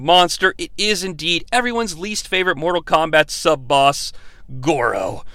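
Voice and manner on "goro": ordinary talk, nothing marked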